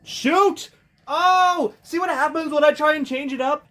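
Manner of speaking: frustrated shout. Real, not acted. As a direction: yell at the screen. Getting hit in game.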